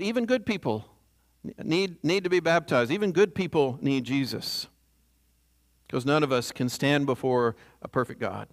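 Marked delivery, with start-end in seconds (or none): none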